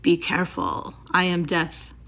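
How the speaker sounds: a lightly voiced snake, low and threatening; low menacing voice. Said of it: "a low voice said".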